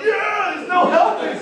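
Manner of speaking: high, loud, slow shout; mock crowd shout. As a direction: yell it back mockingly. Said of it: "they're like".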